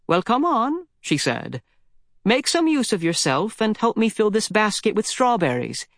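high, brisk granny ordering about; bossy old lady. Acted. Old lady ordering boy to pick strawberries, high and brisk. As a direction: chivvy the boy along like a busy granny.

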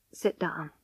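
soft, low, gentle instruction. A narrator's reading. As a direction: ease her down with a quiet voice. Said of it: "he said gently".

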